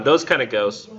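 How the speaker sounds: ordinary delivery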